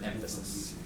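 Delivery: quiet "emphasis" as em-PHA-sis; softly mispronounced "emphasis", weight on the second syllable; wrong-syllable stress. Shown as a demonstration. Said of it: "I changed the emphasis on the different syllable".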